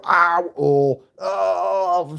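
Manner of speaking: hammed-up wail of pain; mock cry of pain. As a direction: imitate an overdone howl of hurt. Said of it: "I didn't go".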